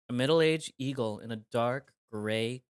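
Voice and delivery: normal talking voice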